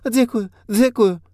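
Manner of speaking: high, slow babbled thanks; babbling thanks. A narrator's reading. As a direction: babble thanks in a rush of relief. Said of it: "лопотала хозяйка".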